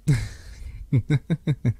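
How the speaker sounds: drawn-out knowing laugh; teasing chuckle. Acted. Keeping a plan from a partner, teasing chuckle.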